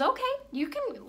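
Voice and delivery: high, lightly acted agreement; frog giving in. Frog agrees to carry the scorpion, high and yielding. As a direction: pitch jumps up on a tentative okay. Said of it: "So he says".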